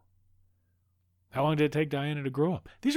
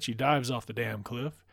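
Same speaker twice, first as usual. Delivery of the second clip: usual speaking voice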